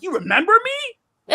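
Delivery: soaring high disbelieving question; amazed question. Retold or imitated. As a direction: ask it thrilled he remembered. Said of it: "I'm like".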